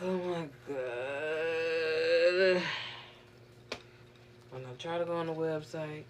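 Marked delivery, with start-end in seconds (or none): 0.0-2.6 long, drawn-out exasperated groan of the words; weary, stretched-out oh my God. Retold or imitated. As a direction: drag it out like you're fed up. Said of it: "I'm like".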